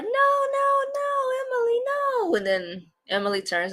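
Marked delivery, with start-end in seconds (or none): 0.0-2.3 very high squeaky cry of no; high pleading Grace. Retold or imitated. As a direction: plead in a much higher girl's voice.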